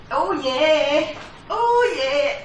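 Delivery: high, drawn-out groan of effort; grunting and groaning. Real, not acted. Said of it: "all the grunting and groaning".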